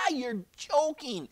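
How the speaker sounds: high, slow, amused disbelief; drawn-out mocking father voice; laughing incredulously. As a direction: scoff through acted laughter. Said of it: "His father began laughing so hard".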